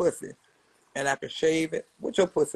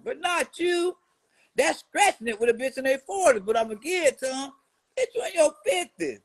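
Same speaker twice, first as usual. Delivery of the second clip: high mocking roast; 6 semitones higher than usual. Real, not acted.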